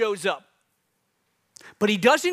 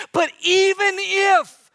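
even if drawn out high; 11 semitones higher, 5 dB louder, much slower than usual. Acted. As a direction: stretch out even, high and slow, then hold. Dramatic pause in prayer.

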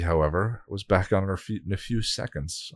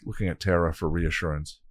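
ordinary delivery

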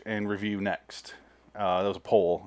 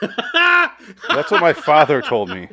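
laughing; 6 semitones higher, 5 dB louder, much slower than usual. Real, not acted. Laughing while telling.

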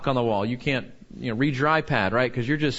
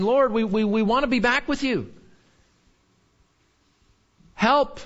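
higher, pleading cry to God; pleading prayer; 7 semitones higher than usual. Pretend. Preacher voicing a plea to God, pleading. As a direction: beg the Lord to take them back.

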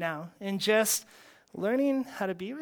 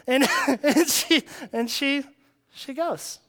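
higher, drawn-out words through real laughter; laughing; 6 semitones higher, 6 dB louder, slower than usual. Real, not acted. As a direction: laugh as you stumble through the retelling. Laughs while telling her reply.

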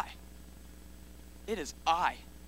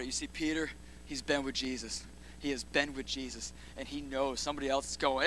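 ordinary talk

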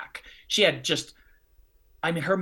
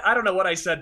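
ordinary talk

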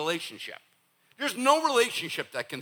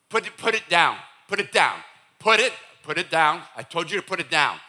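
loud, repeated nagging command; parent scolding; 7 dB louder than usual. Acted. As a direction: nag a four-year-old, growing insistent. Acted parent nagging four-year-old.

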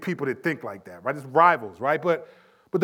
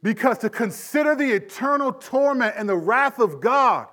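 ordinary talk

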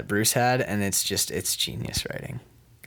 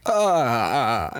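hammed-up moan of delight; mock pleasure moan; 2 semitones higher, 4 dB louder, slower than usual. Actor reacting to camera news, mock moan. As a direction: moan as if overcome, jokingly.

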